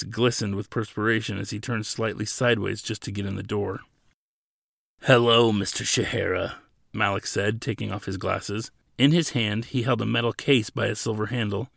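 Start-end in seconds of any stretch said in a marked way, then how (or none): 5.0-6.5 measured greeting, dropping on the name; lightly voiced visitor. A narrator's reading. Imposing visitor greets the prisoner, measured.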